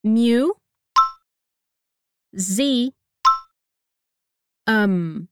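"Mu, zi, um" said one syllable at a time with pauses; split into syllables. Shown as a demonstration. Teacher breaks the word into parts for students, syllable by syllable. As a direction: break "Mu, zi, um" apart, lips closing on the final M. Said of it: "Vamos dividir em partes".

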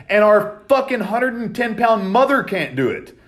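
retelling how he shouted at his brother; commanding yell. Retold or imitated. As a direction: bark at a lazy brother to get moving. Ordering brother out of bed.

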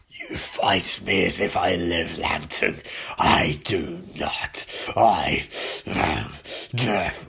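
very low, slow, rasping whisper; throat-straining death villain. Acted. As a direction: growl it like Death itself. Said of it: "my throat".

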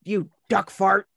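lightly voiced insult, a touch of scorn; mock insult. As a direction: fling the insult playfully. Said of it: "he calls Tom Hanks a duck fart".

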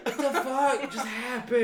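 high, slow, laughing bewildered cry; stunned disbelief. Retold or imitated. Retelling getting hit in the face, high dazed laughing. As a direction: replay the daze of being kneed. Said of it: "And I'm sitting there like".

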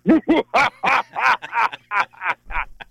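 long mock-evil cackle; hammed-up Halloween villain laugh; thrown-in spooky laugh. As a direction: burst out of an ordinary request into campy ooh-ha-has. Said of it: "gonna keep throwing that in".

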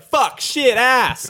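mock exclamation. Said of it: "we were gonna say".

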